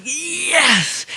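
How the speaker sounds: high, thrilled yelp; excited yes. Retold or imitated. Teen hearing mom approve the tour, high excited cry. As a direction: burst out with delight.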